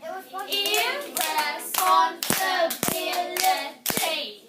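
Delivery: IRRESPONSIBILITY chanted loudly, beat by beat; loud, slow classroom chant of IRRESPONSIBILITY; clapped-out syllables. Shown as a demonstration. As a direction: punch each syllable of IRRESPONSIBILITY evenly. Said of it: "Let's clap it".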